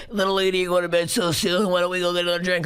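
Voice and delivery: put-on leering old creep voice; lightly voiced sleazy older booker; creepy old man. Comedian imitating the old creep who hit on her. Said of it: "stuck there being him being like".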